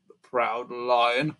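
plain reading voice

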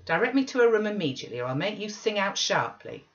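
fast, loud, threatening order; angry exclamation. Acted. Nelly threatening Linton to find Catherine, fast and angry. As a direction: snap the threat at him. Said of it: "I exclaimed".

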